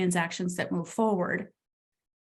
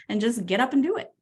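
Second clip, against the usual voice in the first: normal talking voice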